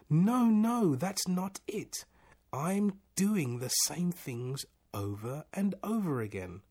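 high, exasperated objection; frantic protest. A narrator's reading. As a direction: burst out high, then drag out the complaint.

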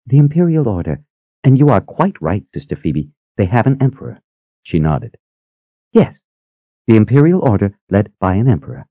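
high, modest, gentle sister; shy and polite, a little high; demure voice. A narrator's reading. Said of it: "in a demure voice".

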